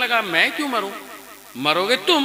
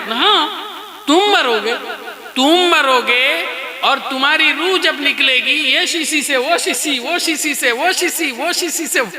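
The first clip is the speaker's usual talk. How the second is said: mocking fakir singsong; 8 semitones higher than usual. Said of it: "بولتا چلا گیا کہ یہ شیشی سے وہ شیشی". (اونچی، دھیمی، چھیڑتی ہوئی گنگناہٹ میں فقیر)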